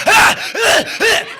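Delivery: high, loud rhythmic yelps; imitated thrusting grunts. [name] humping the amps, loud yelped grunts. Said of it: "Starts humping the amps".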